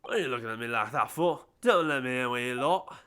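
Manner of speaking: Cockney. Acted. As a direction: grumble in Cockney at the judge.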